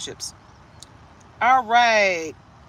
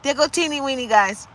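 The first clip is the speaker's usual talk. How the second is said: ordinary talk, nothing marked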